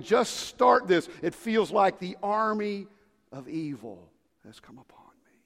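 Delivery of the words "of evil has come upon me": whisper. Acted. Dramatic hush about evil.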